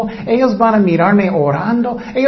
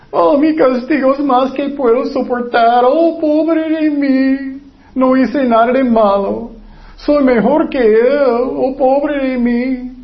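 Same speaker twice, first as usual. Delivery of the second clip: higher, slow self-pitying whine; whining Cain; 6 semitones higher, slower than usual. Acted. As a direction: moan it out like a sulking Cain feeling sorry for himself. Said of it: "¿Y qué dijo Caín?". (Caín quejándose, más agudo y lento, con lástima de sí mismo)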